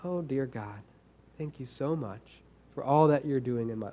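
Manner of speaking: mock the stiff polite prayer, hushed and proper; fake prayer voice. Pastor mocking a fake prayer face, soft pious voice. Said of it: "put on my prayer face".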